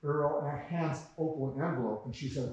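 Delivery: ordinary delivery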